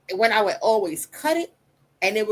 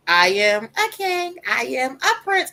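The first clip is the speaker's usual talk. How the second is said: put-on child reciting affirmations; 5 semitones higher, 4 dB louder, slower than usual. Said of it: "Tell me your affirmations".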